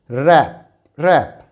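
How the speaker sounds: "रैप" said as "rap", W not sounded; "रैप" modelled for students with no W; silent w. Shown as a demonstration. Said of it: "When W is silent". ("रैप" में W silent)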